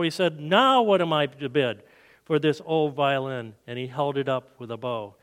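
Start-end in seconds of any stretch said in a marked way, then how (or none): none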